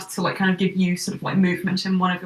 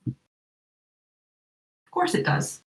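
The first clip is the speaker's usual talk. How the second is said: Elijah voice; 5 semitones lower, 6 dB quieter, much slower than usual. Acted. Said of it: "my Elijah voice isn't on point today".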